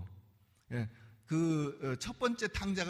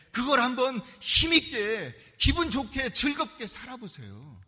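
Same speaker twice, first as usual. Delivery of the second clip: urging loudly; 5 semitones higher, 7 dB louder than usual. Real, not acted. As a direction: push the words out with energy. Preacher urging vigorous living.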